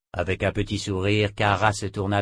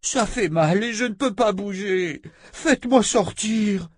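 high, pained plea; whining cry of someone trapped in pain; moaning; 11 semitones higher than usual. A narrator's reading. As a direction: wail it, begging to be let out. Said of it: "gémit Oba".

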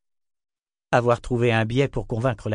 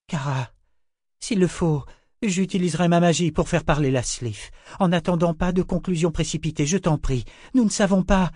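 higher, louder pleading; anxious urging; 8 semitones higher, 3 dB louder than usual. A narrator's reading. Nietzsche begs Kara not to conclude too fast, higher and tense. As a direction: hold back panic and urge her.

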